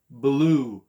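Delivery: "Blue" as buh-loo, loud and stretched; sounded out. Shown as a demonstration. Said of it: "Can you sound it?".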